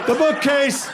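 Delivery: high, laughing repeat of the yell; mock yell. Repeats the yelled line.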